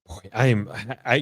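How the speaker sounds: ordinary delivery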